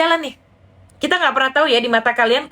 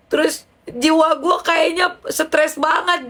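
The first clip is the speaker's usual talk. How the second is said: tearful friend; 4 semitones higher than usual. Retold or imitated. Friend vents her money and stress troubles, tearful. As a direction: pour out troubles in an imitated wail.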